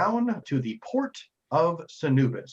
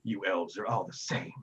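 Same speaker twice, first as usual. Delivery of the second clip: lightly voiced grumbling madam, lower pitch; Madame Scowl; 4 semitones lower, 6 dB quieter than usual. Madame Scowl answers a scolding elf, mildly grumbling low voice. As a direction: grumble it low and a little softer. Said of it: "And Madame Scowl responds".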